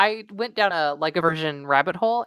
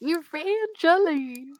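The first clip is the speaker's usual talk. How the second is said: Ray impression; 8 semitones higher, much slower than usual.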